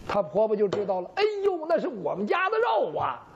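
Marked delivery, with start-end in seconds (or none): 1.2-3.3 a sudden high 哎呦, then blurting out 我们家的肉 with a falling tune; startled cry. Acted. Mother-in-law realizes the dog ate her own meat, startled cry. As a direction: caught off guard, the truth slips out.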